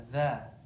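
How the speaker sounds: "dha" as a light th with open a; thin voiced th, unrounded vowel in "dha". Shown as a demonstration.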